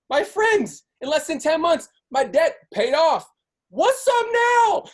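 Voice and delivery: high, excited, building hype; triumphant shout, stretched slow on the last words; hyped up. Real, not acted. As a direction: pump the crowd up, voice climbing high. Hyping the audience.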